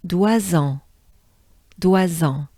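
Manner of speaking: "Doisans" as dwa-zan with a nasal end; French pronunciation. Shown as a demonstration. Teacher drilling "Doisans" syllable by syllable. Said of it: "Here we have wa".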